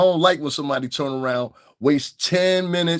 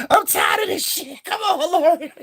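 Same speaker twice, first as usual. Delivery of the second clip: much higher put-on voice; the wig half begging to leave; high cartoon complaint; fed-up wig voice. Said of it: "One side of the wig went".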